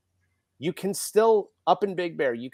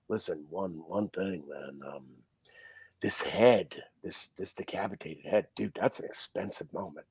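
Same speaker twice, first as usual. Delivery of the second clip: low hushed imitation of Bob; 8 semitones lower, 9 dB quieter than usual. Makeup artist Bob warns about head.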